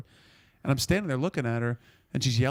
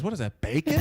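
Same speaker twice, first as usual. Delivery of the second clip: baffled question; 4 semitones higher than usual. Retold or imitated. Retelling a friend's baffled reaction.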